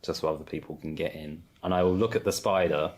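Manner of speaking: normal talking voice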